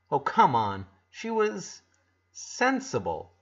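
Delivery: usual speaking voice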